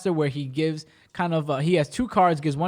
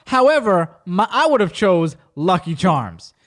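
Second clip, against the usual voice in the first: loud, slow, grand pivot; mock magician; 4 dB louder, slower than usual. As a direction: imitate a showman ignoring the answer. Exaggerated replay of magician's pivot.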